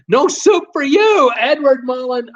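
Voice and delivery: high, loud hammed-up soup-stand refusal; mock stern refusal. Reading a chat request aloud, playing a strict cook with a high, loud mock refusal. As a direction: snap it out like a strict cook turning a customer away.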